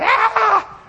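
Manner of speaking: high, sharp yelp of pain; retold scream of pain. Blinded player cries out after a hit, high yelp. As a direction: yelp like you've just been knocked blind. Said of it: "I was screaming".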